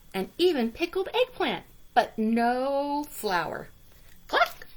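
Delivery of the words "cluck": short high cluck. A narrator's reading. Hen clucking, much higher.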